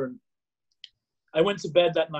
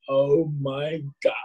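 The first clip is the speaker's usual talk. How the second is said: slow, drawn-out awe; awestruck; much slower than usual. Retold or imitated. Man hearing his new name read out, awestruck. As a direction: stretch each word in wonder.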